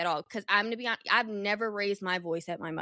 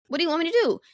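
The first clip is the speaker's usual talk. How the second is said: higher, louder exasperated retort; mock exasperation; 7 semitones higher, 6 dB louder, much faster than usual. Mother brushing off daughter's complaints, mock exasperated. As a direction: throw it back, imitating a fed-up parent.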